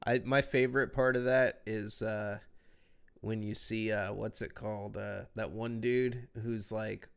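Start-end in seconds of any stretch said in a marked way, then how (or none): none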